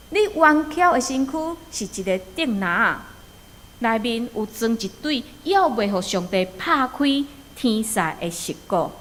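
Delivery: normal talking voice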